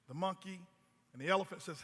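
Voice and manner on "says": ordinary talk, nothing marked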